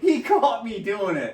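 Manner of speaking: high strained yell; mock shouted alarm; retold shout. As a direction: shout it like you're caught red-handed. Shouting child catching him.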